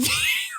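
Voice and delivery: sudden high yelp of a boom hitting; imitated boom crash. Shown as a demonstration. Telling friends how the tortilla hit, sudden yelped boom. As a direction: burst out a sharp crash noise. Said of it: "That was a boom?".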